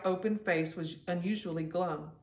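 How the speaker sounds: plain reading voice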